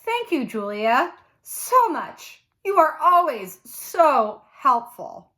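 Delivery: a sugary thank-you that means the opposite; fake gush. A narrator's reading. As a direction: pour on the fake sweetness, slowly. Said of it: "I stand up and gush".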